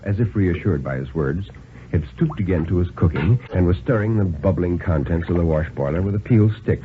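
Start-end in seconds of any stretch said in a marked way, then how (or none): none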